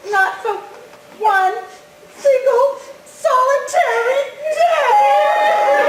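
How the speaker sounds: laugh-cry, stretching single solitary day slowly; laughing through tears. Acted. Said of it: "isn't this ridiculous".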